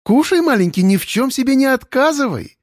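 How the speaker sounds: sweet singsong. Acted. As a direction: coo it sweetly like a song. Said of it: "Сладко пропела она".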